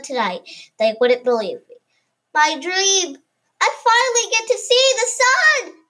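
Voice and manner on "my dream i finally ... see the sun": higher, louder joyful shout; yelled merrily. A narrator's reading. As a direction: cheer it out at the table. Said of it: "I yelled merrily".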